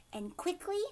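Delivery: ordinary delivery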